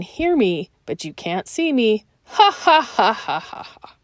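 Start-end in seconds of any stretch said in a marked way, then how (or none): 2.3-4.0 mock villain laugh, fading out; evil villain laugh. Shown as a demonstration. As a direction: cackle like a cartoon villain. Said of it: "I think it sounds like an evil villain laugh".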